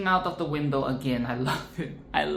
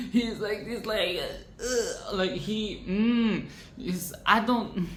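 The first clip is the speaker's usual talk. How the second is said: slimy voice; 5 semitones higher, slower than usual. Pretend. Acting out slimy villain.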